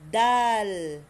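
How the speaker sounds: "Dal" with a firm D and long vowel, loud; tongue behind the gums, stretch out "Dal"; D as in dad. Shown as a demonstration. Teacher models the letter for students, D as in dad. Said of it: "Sound like dad".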